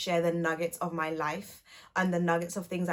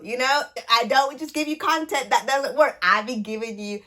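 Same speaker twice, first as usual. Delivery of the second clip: higher, louder sassy brag; 4 semitones higher, 6 dB louder than usual. Pretend. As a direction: show off with attitude. Playful boast, put-on voice.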